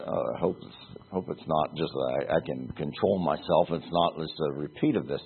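a man quietly dreading he will break down again; inner worried thought. Retold or imitated. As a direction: breathe it like a worry to yourself. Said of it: "And he thought".

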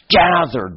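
"Gather" said high and weighty, drawn out; emphasized word. Shown as a demonstration. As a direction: lean hard on "Gather" and let it fall. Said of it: "I emphasize".

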